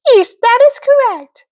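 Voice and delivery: high, sweet fairy voice; sing-song good witch; Glenda the good witch. Acted. Glenda confirms.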